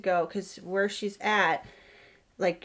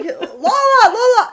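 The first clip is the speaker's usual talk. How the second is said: high, loud urgent call to the dog; 13 semitones higher, 10 dB louder than usual. Retold or imitated. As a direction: call her back off the ice. Calling the dog off the ice.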